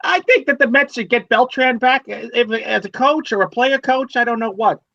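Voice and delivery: thin, high sports call-in caller; radio caller voice. Pretend. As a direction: play a caller on talk radio. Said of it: "and you're calling in".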